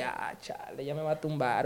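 frightened whisper; whispered aside. Retold or imitated. Host imitating a scared passerby about to be mugged, whispering. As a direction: hiss it under your breath as if about to be robbed. Victim fearing being robbed.

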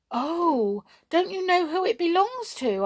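put-on high, loud, urgent little girl; alarmed. A narrator's reading. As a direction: blurt it out in dismay. Mermaid warns of the goblin.

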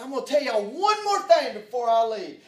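loud, high-pitched retold fury; angry outburst. Preacher re-enacting his parting words to mechanics, mad and shouting. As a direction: raise the voice hard in re-enacted anger. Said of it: "I finally got so mad".

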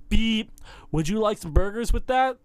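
high, loud mock radio relay; imitating a fast-food order over an earpiece; parroted radio chatter. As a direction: blurt out the overheard burger order. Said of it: "just regurgitates these".

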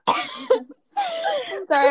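high, slow, laughing through the words; real laughter breaking the apology. Real, not acted. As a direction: giggle out the sorry. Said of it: "I was so shocked by that sentence".

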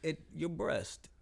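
very slow mock British "your breast"; UK accent. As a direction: draw out "breast" like a smarmy Brit. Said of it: "it might not be U K".

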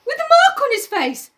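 high frightened schoolboy; frightened Piggy. Acted. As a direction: panic as you search for the child. Piggy voice held.

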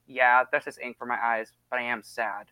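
low, understated imitation of a casual friend; low-key retold admission; mock casual. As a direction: play it offhand, softening at the end. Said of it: "all casual".

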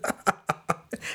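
real giggling burst; helpless laughter. Real, not acted. Dad unable to fake concern for the president, helpless real laughter. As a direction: crack up after failing to stay serious. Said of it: "I can't even say it with a straight face".

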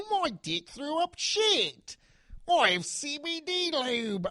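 slow, high mock Australian accent. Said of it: "Australian accent".

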